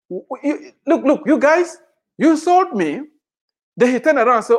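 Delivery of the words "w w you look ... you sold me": a hint of Joseph, higher and stammering; Joseph's voice. Preacher voices Joseph confronting his brothers, lightly higher. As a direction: voice Joseph stumbling over his words.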